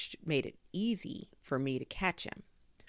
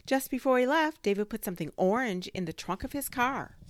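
excited; 8 semitones higher, 7 dB louder than usual. A narrator's reading. Gio eager report.

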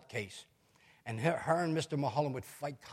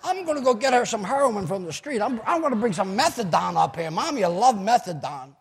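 loud, raised-pitch sarcastic rant; mock defiance; 5 semitones higher, 9 dB louder than usual. Son mockingly offering drugs to his mother, loud sarcasm. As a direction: play up an outrageous offer, loudly.

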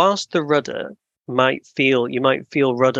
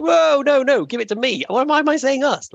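much higher, mock-alarmed protest; startled self-correction; 12 semitones higher than usual. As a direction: catch yourself in comic alarm. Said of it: "And I'm like".

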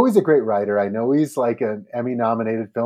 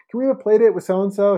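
higher-pitched imitation of a pleading child; a hint of an eager child, pitched up; asking kid; 8 semitones higher, 4 dB louder than usual. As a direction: lightly voice a kid asking a parent. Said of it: "has your kid asked you".

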